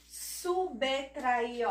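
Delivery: subtrair as "su-bê-tra-ir"; name the B inside Subtrair as "bê"; "Subtrair" in deliberate syllables, bê stressed; consonant named. Shown as a demonstration. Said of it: "você fala só a consoante".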